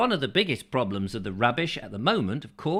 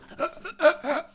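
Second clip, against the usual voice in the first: high laugh. Real, not acted. Laughs at own joke.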